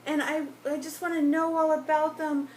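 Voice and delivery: high, loud, gushing retold voice; eager wife. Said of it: "'Cause she was like".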